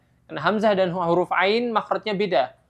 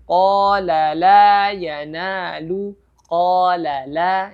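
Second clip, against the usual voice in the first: slow chanted recitation, louder; Quranic recitation; 4 dB louder, much slower than usual. Shown as a demonstration. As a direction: recite slowly with held vowels. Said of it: "Jadi bacanya harus mat tabi'i dua harakat".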